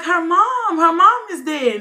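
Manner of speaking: high, loud cry of bad news; imitated distress. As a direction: wail it out to the room. Imitates cellmate announcing the death.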